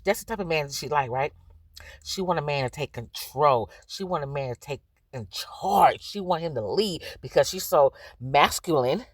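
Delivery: ordinary delivery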